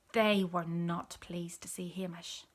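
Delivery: ordinary delivery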